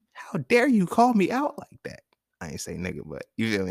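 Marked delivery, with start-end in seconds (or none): 0.1-2.0 high, loud, drawn-out mock outrage; mock indignation. Man protesting to the woman who called him out, loudly indignant. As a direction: stretch it out in a high-pitched, offended cry. Acting out his indignant comeback to her.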